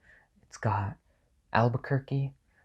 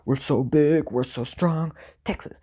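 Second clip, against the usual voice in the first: higher, puffed-up caricature voice; mock Texan boasting; 4 semitones higher, 3 dB louder than usual. Ranker mimicking proud Texans, mock boastful. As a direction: imitate a self-important Texan, pitched up.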